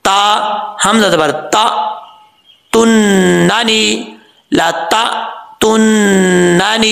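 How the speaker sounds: तुन्नानी slow, doubled n, clean hamza; तुन्नानी drawn out, nn held; correct hamza recitation. Shown as a demonstration. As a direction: recite ता तुन्नानी slowly with no jerk. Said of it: "कैसे?".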